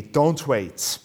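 usual speaking voice

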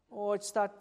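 ordinary talk

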